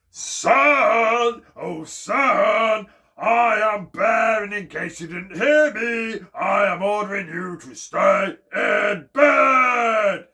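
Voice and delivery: booming, drawn-out shout, pitched high; loud shout. A narrator's reading. Bear ordering the sun to stay in bed, shouting. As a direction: bellow it slowly up to the sky. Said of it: "He shouted a bit louder this time".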